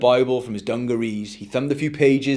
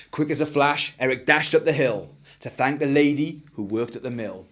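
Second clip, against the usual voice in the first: ordinary delivery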